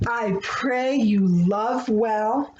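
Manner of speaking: ordinary delivery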